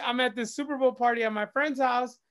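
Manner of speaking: ordinary talk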